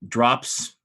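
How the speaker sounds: ordinary delivery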